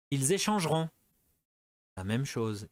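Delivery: ordinary talk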